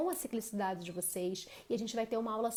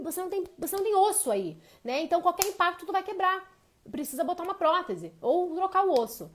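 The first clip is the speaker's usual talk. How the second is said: higher, louder doctor's warning; loud, higher stern warning; 6 semitones higher, 5 dB louder than usual. Retold or imitated. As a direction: lay down the hard news, raised. Doctor's blunt warning quoted.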